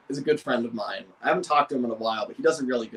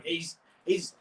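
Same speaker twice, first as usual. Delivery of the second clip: ordinary delivery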